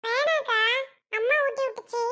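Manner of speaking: put-on young boy asking his big sister; little boy. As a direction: play the little brother, curious. Little brother refuses again.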